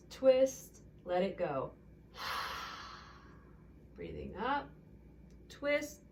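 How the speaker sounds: breathing cues ending in audible release sighs; calm yoga teacher cueing each twist; guided breath and twist. Acted.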